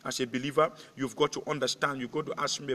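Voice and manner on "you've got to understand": ordinary talk, nothing marked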